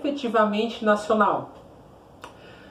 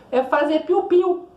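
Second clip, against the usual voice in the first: playful birdsong; 8 semitones higher than usual. Acted. Teacher jokes about birdsong.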